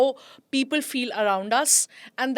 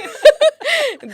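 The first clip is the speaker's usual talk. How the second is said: laugh; 10 dB louder than usual. Real, not acted. Laugh after story.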